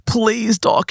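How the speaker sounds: high, urgent mock pleading; desperate begging. Patient begs the doctor to remove the spirit, desperate pleading. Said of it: "she's begging for help".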